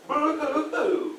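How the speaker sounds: high, hammed-up boo-hoo sobbing; mock blubbering boo hoo. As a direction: cry it out like a bawling kid. Imitating his own crying.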